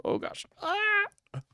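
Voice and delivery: sudden high frightened shriek; startled scream. Real, not acted. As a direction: yelp as you get caught off guard. Startled while dodging game enemies.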